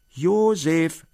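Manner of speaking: loud, high call of the name. A narrator's reading. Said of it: "rief der Onkel wieder".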